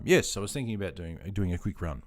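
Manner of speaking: normal talking voice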